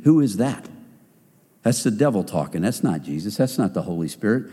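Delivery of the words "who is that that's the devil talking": normal talking voice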